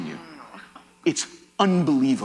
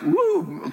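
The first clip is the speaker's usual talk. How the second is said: light, high-pitched mock-impressed woo; 12 semitones higher than usual. Patient jokes about his high triglycerides, playful woo. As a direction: let out a playful high whoop.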